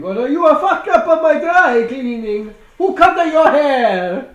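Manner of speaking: high mock Italian accent, slowing on the question. Dry cleaner scolding the clown in a mock Italian accent. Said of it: "Italian accent thing".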